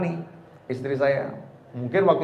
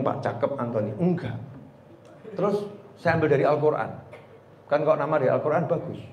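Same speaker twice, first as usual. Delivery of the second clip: ordinary talk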